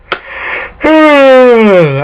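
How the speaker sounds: long, loud yawning sigh, pitched high; a big tired yawn let out fully. Real, not acted. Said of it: "excuse me as I yawn".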